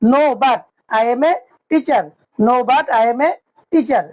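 each word stretched slowly; very slow model. Shown as a demonstration. Teacher slowly repeats the full English answer for students. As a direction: drill the whole answer slowly. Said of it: "ইংরেজি হবে".

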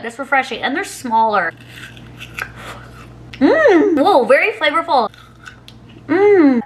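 biting frozen treat; talking while chewing; mouth full of ice pop. Real, not acted. Seen 3: Red ice pop bitten at mouth 0.6–1.5s, then chewing, mouth closed.

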